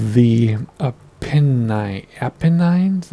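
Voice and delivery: "Apennines" said APP-uh-nines, slowly; Apennines ending in "nines". Shown as a demonstration. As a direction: say "Apennines" with a long I, rhyming with nines. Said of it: "Is it Apennines or".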